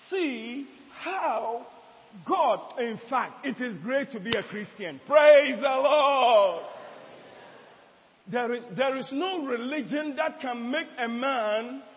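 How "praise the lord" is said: very slow and loud, stretching each word; drawn-out praise shout. Real, not acted. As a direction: call out praise to the whole church. Preacher exhorting congregation.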